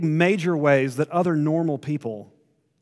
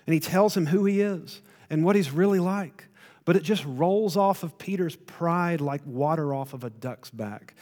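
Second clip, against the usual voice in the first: ordinary talk, nothing marked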